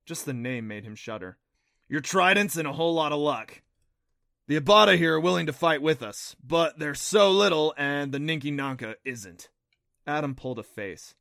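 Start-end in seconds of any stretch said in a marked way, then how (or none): 1.9-9.5 lightly voiced creature guide, louder; a hint of an earnest guide character; creature guide explaining. A narrator's reading. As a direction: explain it briskly and loudly. Narrator voices Neff, the creature guide.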